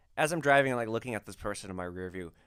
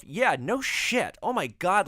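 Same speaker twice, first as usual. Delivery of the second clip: exasperated; 8 semitones higher, 8 dB louder, slower than usual. Retold or imitated. Exasperated inner reaction.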